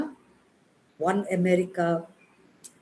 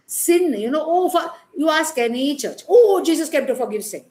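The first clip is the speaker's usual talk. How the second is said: mock church line; 7 semitones higher, 6 dB louder than usual. Mocking what churches say.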